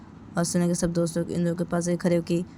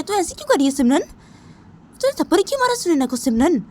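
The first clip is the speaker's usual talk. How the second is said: higher, lightly voiced friend demanding answers; scolding friend; 10 semitones higher, 5 dB louder than usual. Simran's friend scolding her over the slap, higher. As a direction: confront her about the slap.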